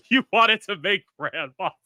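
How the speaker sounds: loud, high-pitched laughing echo; laughing disbelief. Real, not acted. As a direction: repeat it back, cracking up. Amused disbelief at the answer.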